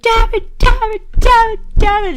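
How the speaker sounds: high, loud, hammed-up cursing at the doll; high mock rage shouts of damn it; mock frustrated yelling. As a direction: let it rip like beating a doll. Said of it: "just be like".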